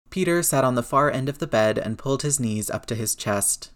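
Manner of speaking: plain reading voice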